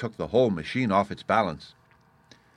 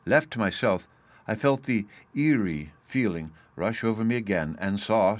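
plain reading voice

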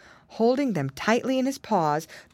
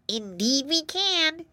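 high, slow, drawn-out reply; little bee voice; 10 semitones higher, slower than usual. A narrator's reading. Second bee agrees up in the air, piping high. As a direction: answer high as a tiny bee.

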